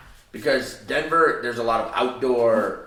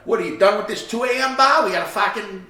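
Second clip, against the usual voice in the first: put-on loud, high Boston accent; rowdy Boston bar voice in Boston accent; 9 semitones higher, 5 dB louder than usual. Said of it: "that's a Boston accent".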